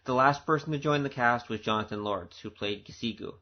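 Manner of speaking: ordinary delivery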